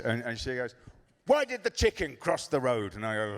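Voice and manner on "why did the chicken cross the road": put-on high, sing-song little girl's riddle; child telling joke. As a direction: pose a riddle like an eager kid. Daughter tells her joke.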